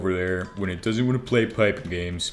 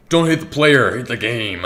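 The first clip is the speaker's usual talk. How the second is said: joking catchphrase; 3 semitones higher, 4 dB louder than usual. Pretend. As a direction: toss it out like a punchline. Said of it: "it just sounds funny".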